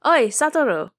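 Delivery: loud, high call across the kitchen; yelling. A narrator's reading. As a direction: shout the name out. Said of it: "Kitamoto yells".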